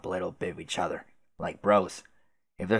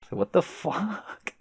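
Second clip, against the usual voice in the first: incredulous; 12 semitones higher, slower than usual. Real, not acted. Incredulous outburst.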